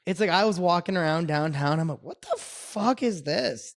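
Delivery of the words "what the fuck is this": mock disbelief. Disbelief at rowdy downtown bars.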